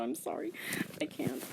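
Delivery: ordinary delivery, nothing marked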